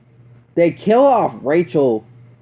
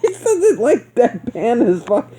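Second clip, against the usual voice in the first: laughing; 9 semitones higher than usual. Real, not acted. Laughing at silly scene.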